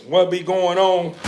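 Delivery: ordinary talk, nothing marked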